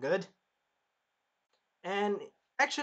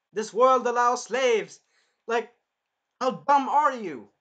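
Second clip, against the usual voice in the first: loud, higher, slow scolding; exasperated rant; 7 semitones higher, 9 dB louder, slower than usual. Acted. Naofumi scolding the spear hero, slow loud exasperation. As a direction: spell out his stupidity.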